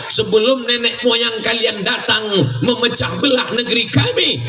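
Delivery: ordinary talk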